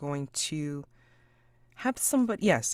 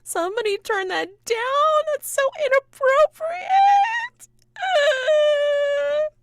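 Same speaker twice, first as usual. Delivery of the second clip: high-pitched, loud, wailing shout; wailing outburst, drawn out at the end; hysterical offstage yell; 12 dB louder, slower than usual. Acted. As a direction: shriek a complaint through the door. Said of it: "you hear coming from inside".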